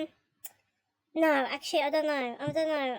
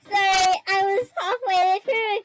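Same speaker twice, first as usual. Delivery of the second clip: louder apologies through laughter; laughing fit; 6 semitones higher, 6 dB louder than usual. Real, not acted. Streamer cracking up mid-sentence, apologising.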